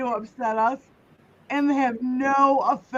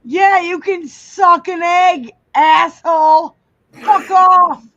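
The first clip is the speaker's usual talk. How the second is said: loud, higher-pitched telling-off; angry insult; 4 semitones higher, 10 dB louder than usual. Real, not acted. Host to a chat troll, loudly angry.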